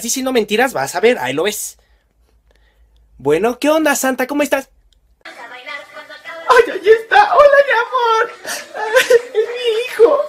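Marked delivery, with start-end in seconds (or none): 5.2-10.2 high, loud laughing sob; tearful joy. Acted. Mother sees her son on screen, laughing and crying with joy. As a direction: gush with joy and tears.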